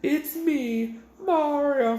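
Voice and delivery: high mock Mario call from the next room; imitating Mario. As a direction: squeak it like a stoned friend doing Mario. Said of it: "and we just hear".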